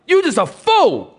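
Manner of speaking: high, loud, scornful; loud scornful rebuke. Shown as a demonstration. Preacher redoing God's rebuke, high and scornful. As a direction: spit it out at him. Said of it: "No, no, no".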